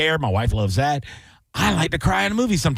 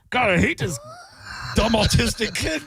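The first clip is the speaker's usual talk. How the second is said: mock grandpa; 7 semitones higher than usual. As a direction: shout the complaint in a put-on old man voice. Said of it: "he's like".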